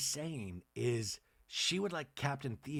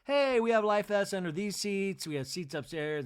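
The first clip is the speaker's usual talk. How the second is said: average voice; 6 semitones higher, 4 dB louder than usual. Retold or imitated. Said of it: "it was just like".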